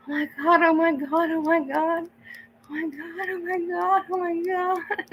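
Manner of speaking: high-pitched shrieks of joy; shrieking jackpot winner. Real, not acted. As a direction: shriek like you just won big. Excited reaction to a donation.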